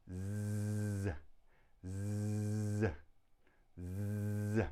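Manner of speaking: z held as a long, level "zzzz" buzz; isolated z sound. Shown as a demonstration. Teacher answers how we pronounce it, z as a long buzz. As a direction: sustain the buzz of z, not the letter's name. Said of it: "How do we pronounce Z".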